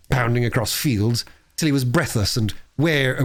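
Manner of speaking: plain reading voice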